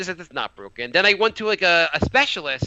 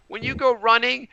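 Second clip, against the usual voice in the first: put-on higher, slow kid-style advice; imitated young daughter; 6 semitones higher, slower than usual. Daughter advising dad about running, earnest and imitated. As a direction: spell it out like a child instructing a parent.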